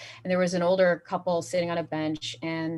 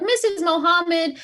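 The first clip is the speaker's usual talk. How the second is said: mock teacher call; 9 semitones higher, 4 dB louder, slower than usual. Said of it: "people will be like".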